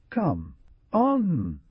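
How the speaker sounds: high, drawn-out mock; slow, mocking storybook retort. A narrator's reading. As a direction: scoff slowly on a high pitch. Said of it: "replied the Skinner with a mocking accent".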